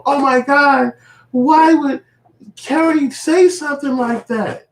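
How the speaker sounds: very high, slow, gushing mock outrage; mock gasp of sympathy. Sympathizers gasping over Kerry, high hammed-up shock.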